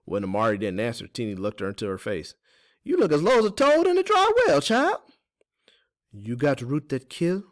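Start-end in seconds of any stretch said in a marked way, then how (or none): 2.8-5.0 very high, loud character voice; folksy Teeny, teasing concern. Acted. 6.1-7.4 slow, slightly raised question, understated gloom; glum. A narrator's reading. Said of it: "Amari asked glumly".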